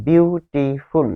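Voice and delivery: Beautiful said slowly but whole; slow example word. Shown as a demonstration. Teacher gives beautiful as an English example, slowly. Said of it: "ইংরেজিতে বলা হয় syllable".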